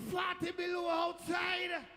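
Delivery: high, loud, drawn-out bellow; shocked shout. Retold or imitated. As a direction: yell it out slowly at the cold. Shock at the freezing cold.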